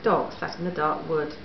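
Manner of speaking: usual speaking voice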